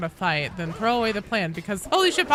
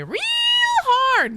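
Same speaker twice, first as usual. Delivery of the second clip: "real" stretched out high and loud; stress on REAL; 5 dB louder than usual. Pretend. Streamer urging prayer to God Emperor, "real" drawn out high. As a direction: hammer "real" with a long high squeal. Hammed-up advice to pray.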